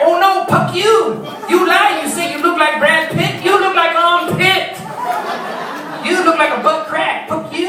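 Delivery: high, loud mock-foreign bride's tirade; hammed-up angry shriek of a jilted bride; outraged bride. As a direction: hurl the insults indignantly, drawing out key words. Furious mail-order bride, acted quarrel.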